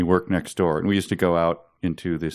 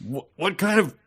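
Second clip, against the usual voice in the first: mock fussy boss; 7 semitones higher than usual. Mocking the boss's complaint.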